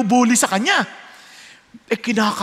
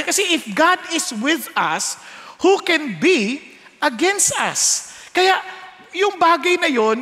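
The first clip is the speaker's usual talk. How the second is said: rising preacher; 4 semitones higher, slower than usual. Acted. Preaching climax.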